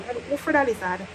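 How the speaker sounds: usual speaking voice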